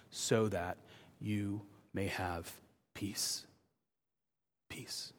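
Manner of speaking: very soft, low and slow, with pauses; hushed and gentle. Real, not acted. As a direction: let each phrase sink almost to a whisper. Preacher gently offers peace to congregation.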